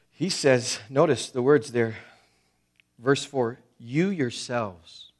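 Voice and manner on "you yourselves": slow, each word of "you yourselves" set apart and stressed; emphasis on you yourselves. Shown as a demonstration. Preacher stressing God's words to the people, slow and weighty. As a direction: lean hard on "you yourselves". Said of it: "Notice twice".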